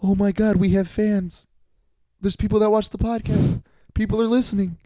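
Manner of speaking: high-pitched giddy amazement, acted out; excited first thought. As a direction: play up the thrill of discovering fans. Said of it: "my first thought was".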